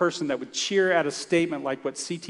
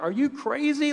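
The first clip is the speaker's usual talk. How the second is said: much higher, disbelieving cry; incredulous; 11 semitones higher than usual. Retold or imitated. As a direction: throw up your hands in disbelief. Speaker reacts to risky witnessing.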